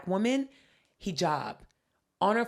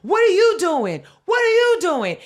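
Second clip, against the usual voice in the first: much higher and louder, shouting; two customers ganging up on the clerk, yelling; angry yelling; 11 semitones higher, 11 dB louder than usual. Retold or imitated. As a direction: yell it at the clerk together. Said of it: "they're like".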